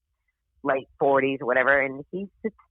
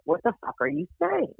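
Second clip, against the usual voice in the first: appalled; 9 semitones higher than usual. Retold or imitated. Friend kicking her under the table, appalled. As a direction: imitate an appalled friend, pitched up.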